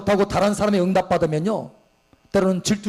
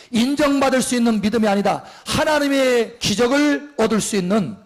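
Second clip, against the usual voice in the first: usual speaking voice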